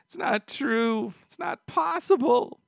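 high, crying shout of denial, slowing down; anguished iconic no. Retold or imitated. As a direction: wail the no in despair. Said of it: "one of the most iconic no's in film history".

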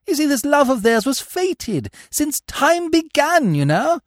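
put-on high, airy young gentleman; blithe young Bertie. A narrator's reading. Bertie arguing for his friend's love, blithe and earnest. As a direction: plead the case lightly and high.

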